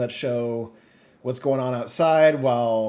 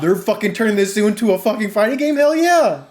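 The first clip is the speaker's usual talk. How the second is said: much higher and louder, peaking on hell yeah; hyped shout; 9 semitones higher, 8 dB louder than usual. Retold or imitated. Fan seeing health bars appear, hyped shout. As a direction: cheer like the screen just lit up. Hyped reaction, says got hyped.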